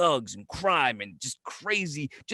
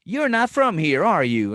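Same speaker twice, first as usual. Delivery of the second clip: loud, high-pitched friendly local, lightly imitated; Southern charm lady; 3 semitones higher, 6 dB louder than usual. Florida local greeting a newcomer, mock Southern charm. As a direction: play the sweet Southern waitress greeting a stranger. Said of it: "the [name] Southern Charm lady".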